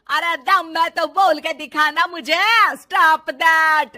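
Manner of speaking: defiant challenge. Acted. Mother-in-law challenges bahu.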